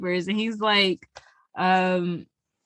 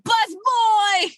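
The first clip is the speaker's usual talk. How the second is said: high, loud mock shout; 11 semitones higher, 4 dB louder than usual. Friend voicing the thug calling out the hero, shouting. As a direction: bellow the nickname across the room.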